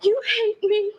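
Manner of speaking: crying. Acted. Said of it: "Carol, are you crying?".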